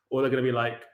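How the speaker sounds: usual speaking voice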